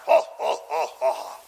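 mock stage laugh, falling in pitch; separate, hammed-up "ho" laughs; villainous laugh. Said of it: "And then he laughs".

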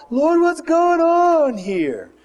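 high, drawn-out wail of mock dismay; anguished cry to God. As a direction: call up to the Lord, stretched out and loud. Dismayed people crying out to God.

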